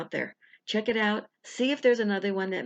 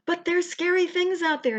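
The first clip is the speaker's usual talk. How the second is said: high, timid little fearful voice; fearful subconscious; 10 semitones higher than usual. Acted. Subconscious warning the viewer, high and timid. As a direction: whimper it like a scared inner child. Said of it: "It's your subconscious going".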